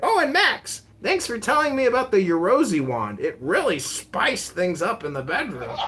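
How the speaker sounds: mock dad voice. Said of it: "He's like".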